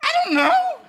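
high laughing shout of a woman; high comic woman's yell. Acted. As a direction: play the flustered woman, laughing loud.

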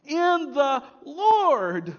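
slow, loud, drawn-out In the Lord; emphasis on Lord. Shown as a demonstration. Pastor reading verse one, stressing Lord slowly. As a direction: stretch out In the Lord, leaning on Lord. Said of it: "Look at verse one".

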